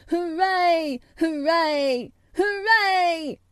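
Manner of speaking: loud, high, drawn-out hooray; shouted cheer. A narrator's reading. Strong healthy boy cheering the news, shouting. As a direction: cheer it out at full voice, three times over. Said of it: "would probably have shouted".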